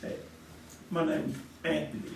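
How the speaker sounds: ordinary talk, nothing marked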